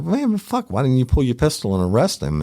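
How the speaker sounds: high, exasperated retort; incredulous. Retold or imitated. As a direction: throw it back at him in disbelief.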